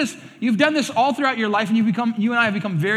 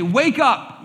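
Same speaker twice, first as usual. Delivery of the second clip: wake-up call; 5 semitones higher, 5 dB louder than usual. Retold or imitated. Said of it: "that is to say".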